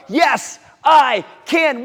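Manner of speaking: high, loud shout; building class shout. Shown as a demonstration. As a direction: shout it with full power. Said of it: "Two more".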